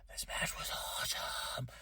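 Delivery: breathless whisper of awe; hushed awestruck whisper; awed whisper. Real, not acted. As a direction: whisper this match was awesome. Awed about match.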